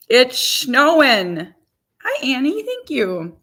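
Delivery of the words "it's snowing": slow, drawn-out, loud; slow amazed reveal. Real, not acted. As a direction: draw it out like a big reveal. Reveals snow view.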